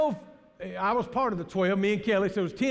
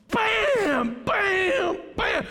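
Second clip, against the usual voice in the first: loud mock bangs for the bump; three hammed-up bams; impact noise; 10 semitones higher, 4 dB louder, much slower than usual. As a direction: shout each bam like a hit. Van hits speed bump.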